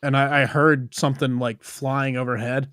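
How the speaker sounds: normal talking voice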